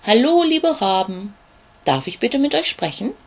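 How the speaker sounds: high, loud little-beetle call; calling out bravely. Acted. Little ladybug calls up to the huge ravens, high and loud. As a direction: call up to the big birds, mustering courage. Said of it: "nimmt er all seinen Mut zusammen und ruft".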